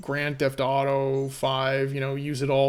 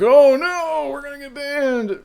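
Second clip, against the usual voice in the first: much higher, loud squeal of alarm; mock panic; 10 semitones higher, 5 dB louder than usual. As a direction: play the panicked stream audience. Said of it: "They'd be like".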